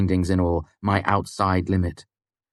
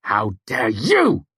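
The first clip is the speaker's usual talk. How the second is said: louder, slow angry retort; spat back angrily; 4 semitones higher, 5 dB louder, slower than usual. A narrator's reading. Kvothe throwing her words back, angry and slow. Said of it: "I spat back angrily".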